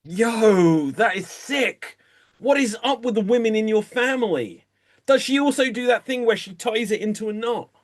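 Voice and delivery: high, fast, appalled disbelief; shocked friend. Acted. Friend reacting to the family secret, shocked and high. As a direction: gasp at the gross news.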